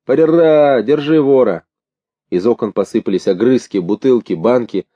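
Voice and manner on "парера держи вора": high, loud drawn-out refrain; raucous, raised chant; bawled ditty. A narrator's reading. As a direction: belt out the refrain like a rowdy passenger. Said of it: "Кто-то модную песенку заорал".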